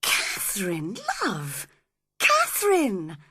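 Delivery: a stunned lover calling her name; shocked cry. A narrator's reading. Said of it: "cried Edgar, shocked".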